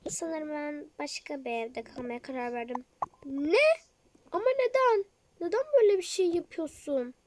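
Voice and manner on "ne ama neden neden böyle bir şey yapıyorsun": shocked outcry. Acted. Friend shocked she is leaving.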